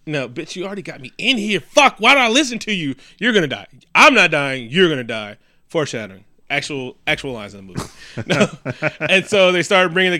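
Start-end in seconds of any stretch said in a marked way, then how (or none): 0.1-5.4 mock panicked rant. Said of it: "The bitch is like".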